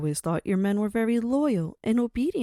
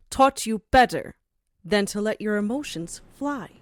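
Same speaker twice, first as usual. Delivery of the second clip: usual speaking voice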